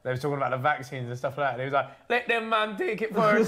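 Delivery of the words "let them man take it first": high, slow Jamaican impression; Jamaican accent. Quoting an island leader on vaccines, put-on Jamaican accent. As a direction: imitate a Jamaican leader in a raised, drawn-out voice. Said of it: "that was a cold Jamaican accent".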